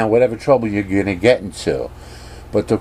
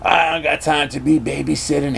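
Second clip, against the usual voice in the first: higher-pitched complaint without real volume; half-hearted acted yelling; irritated mock yell; 6 semitones higher than usual. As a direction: protest like an annoyed Han. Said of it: "Han Solo's yelling".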